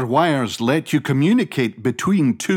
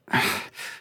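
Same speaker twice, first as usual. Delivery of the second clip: soft voiceless sigh; 7 dB quieter than usual. Acted. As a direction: let the breath out. Inventor working at night.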